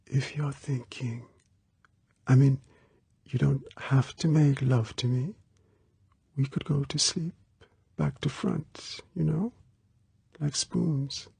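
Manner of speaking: put-on high, very soft, hesitant girl; almost a whisper. A narrator's reading. Girl shyly suggesting sleeping like spoons, almost whispering. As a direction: offer it haltingly, barely above a whisper. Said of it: "almost in a whisper".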